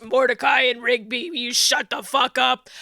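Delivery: lightly voiced raspy old man; a hint of a tired old man telling them off; Skips voice. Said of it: "He's talking like this".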